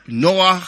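"Noah" with a rasped final ch, as in Hebrew; Hebrew pronunciation. Shown as a demonstration. Preacher showing the congregation Noah's name, Hebrew pronunciation with throaty final ch on "Noah". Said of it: "Hebrew, Ivrit".

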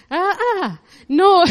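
high laughing protest. Real, not acted. Preacher laughing off hidden tears, laughing.

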